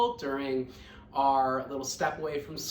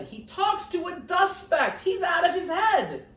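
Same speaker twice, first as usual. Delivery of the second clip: much higher mocking gossip; gossiping jungle; 12 semitones higher, 4 dB louder than usual. Jungle animals spreading news about Horton, high mocking jeer. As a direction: jeer at the crazy elephant.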